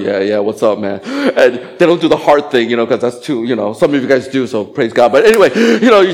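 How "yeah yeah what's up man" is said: cool casual. Retold or imitated. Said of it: "trying to keep their cool".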